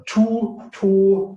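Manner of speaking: "two" with a long, held vowel. Shown as a demonstration. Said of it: "it's long, both is long".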